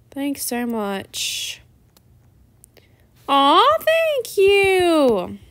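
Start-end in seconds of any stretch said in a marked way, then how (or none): 3.3-5.4 gushing. Real, not acted. Touched by a compliment.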